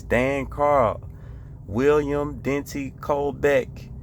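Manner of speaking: ordinary delivery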